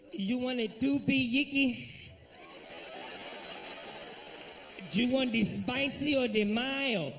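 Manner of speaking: put-on a cashier struggling with the order in a Spanish accent; hammed-up Spanish-accented cashier; mock Spanish accent. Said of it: "He tried to repeat the shit back".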